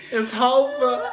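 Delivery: high, slow laughing surfer dude. Retold or imitated. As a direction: stretch it out like a stoned surfer. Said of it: "he sounds kinda like a surfer".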